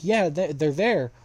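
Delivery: acted-out hurried confirmation; excited tattling. Character points out the intruders.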